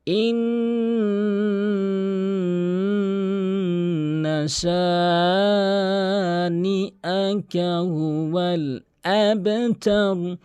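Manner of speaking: slow, high, drawn-out chanted recitation; Quran recitation. Acted. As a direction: intone each word long and melodic. Recites the next verse.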